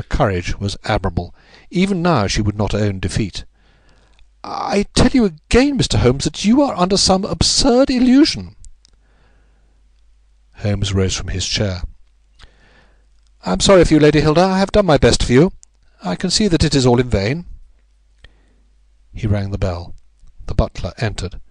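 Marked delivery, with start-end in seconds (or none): 4.4-8.5 high-pitched lady refusing to admit defeat; Lady Hilda, defiant. A narrator's reading. 13.4-17.5 raised-voice detective giving up on her; Holmes, resigned and firm. A narrator's reading.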